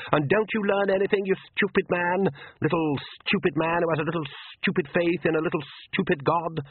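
lightly voiced vampire count, scornful; a hint of a contemptuous count, hitting stupid; sneering vampire count. A narrator's reading.

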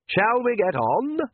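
slow, high mock-polite vampire count; lightly voiced vampire count. A narrator's reading. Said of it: "said the Count".

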